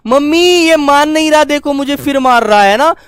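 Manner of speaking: put-on a kid crying out to mum for help; calling for mum. Child calling mum during a sibling fight. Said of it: "mummy को आवाज लगाएगा".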